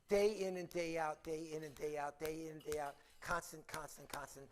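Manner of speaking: low, soft, droning list; monotonous repetition. Retold or imitated. Speaker conveying endless sameness to listeners, in a low drone. Stressing the endless repeated routine.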